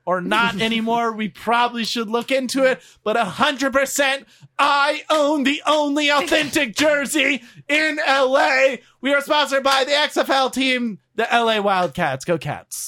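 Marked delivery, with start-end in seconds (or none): 4.6-12.2 announcer voice. Acted. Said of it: "[name] audition for being a, a, an announcer".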